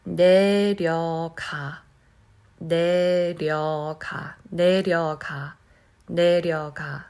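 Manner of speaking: 내려가 said slowly with the tongue touching the roof; drawn-out practice 내려가, tongue tapping; slow careful demo. Shown as a demonstration. Teacher modelling the slow practice version, slow careful 내려가. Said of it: "I'm going to pronounce this slowly".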